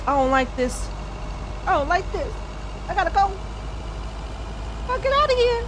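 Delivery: unease rising to a high squeak; creeped out. Real, not acted. Fleeing a woman stalking her in game.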